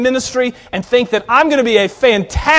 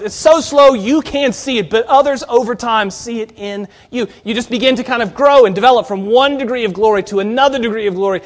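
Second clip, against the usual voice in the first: ordinary delivery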